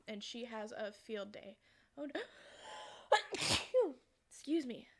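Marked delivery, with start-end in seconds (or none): 2.6-3.9 explosive airy sneeze; sudden sneeze. Real, not acted. Vlogger interrupted mid-story, real explosive sneeze. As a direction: let a real sneeze burst out.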